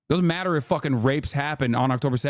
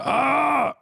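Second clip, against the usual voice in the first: sudden much higher hammed-up yelp; mock scream; 12 semitones higher than usual. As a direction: fake a shriek of rage for laughs. Mocking critics' outrage.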